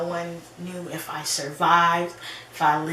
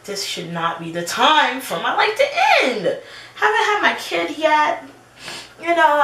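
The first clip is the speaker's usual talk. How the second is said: pleading; 6 semitones higher, 5 dB louder than usual. Retold or imitated. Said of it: "And I was like".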